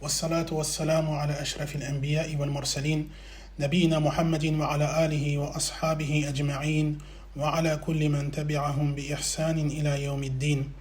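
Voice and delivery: low, soft, rapid monotone recitation; formal Arabic invocation. Acted. Lecturer opens lesson with praise and blessings, formal recitation. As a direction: recite the opening formula evenly and quickly, voice kept down.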